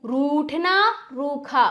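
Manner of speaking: रूठना and रूखा with a lengthened oo; long vowel. Shown as a demonstration. Teacher giving examples of big u, long oo in रूठना, रूखा. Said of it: "उसको थोड़ा खींचना पड़ता है".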